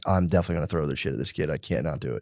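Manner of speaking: fast, low, soft self-talk; hushed, defeated inner monologue; muttered resolve. Retold or imitated. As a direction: mutter it to yourself in a hurry. Inner resolve of child at school.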